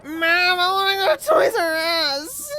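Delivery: put-on high, loud whining kid; whiny child voice. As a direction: wail it like a spoiled brat. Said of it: "the annoying child voice".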